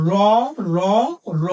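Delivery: "র, র, র" as three matching sounds, spaced apart; identical sounds. Shown as a demonstration. Teacher showing three letters are all র, "র" pronounced alike each time. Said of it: "তিনটাই হলো র".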